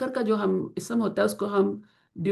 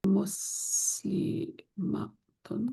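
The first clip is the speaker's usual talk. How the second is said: whispered word; 7 dB quieter, much slower than usual. Shown as a demonstration. Teacher writing on the board, whispering slowly. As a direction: whisper مسلمتن slowly to herself. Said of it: "teacher writing the word".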